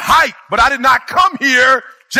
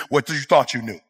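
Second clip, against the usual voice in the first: ordinary delivery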